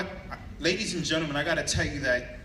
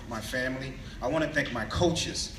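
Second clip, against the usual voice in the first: usual speaking voice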